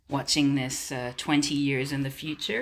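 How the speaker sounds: usual speaking voice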